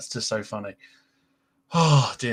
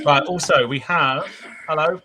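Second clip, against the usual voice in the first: normal talking voice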